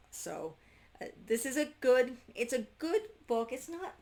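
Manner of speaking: ordinary talk, nothing marked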